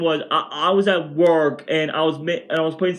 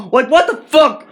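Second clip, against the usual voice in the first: angry outburst; 10 semitones higher, 8 dB louder than usual. Real, not acted. Angry rant about mom's payback.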